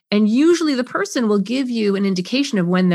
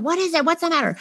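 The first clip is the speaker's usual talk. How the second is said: higher, curt questions; impatient parent; 8 semitones higher, faster than usual. Retold or imitated. Parent walking upstairs to the kid, impatient. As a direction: ask it fed up and rushed. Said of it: "walk upstairs and do that".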